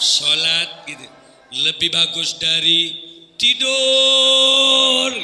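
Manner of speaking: mock wrong emphasis; stress on tidur. Shown as a demonstration. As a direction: end loud on tidur. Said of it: "Kalau diterjemah".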